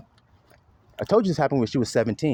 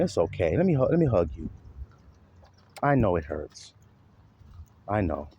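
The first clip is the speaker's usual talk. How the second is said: lower, softer consoling voice; tender comforting; 6 semitones lower, 4 dB quieter than usual. Retold or imitated. Showing how a mother should comfort a crying son, soft and tender. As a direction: hush and comfort a hurting child. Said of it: "Not even".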